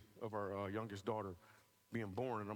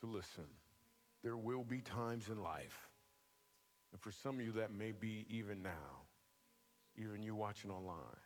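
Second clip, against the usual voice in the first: soft preaching; 4 semitones lower, 4 dB quieter than usual. Acted. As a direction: draw them in gently, fading softer. Soft altar-call preaching over music.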